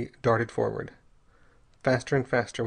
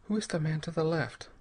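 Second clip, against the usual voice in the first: usual speaking voice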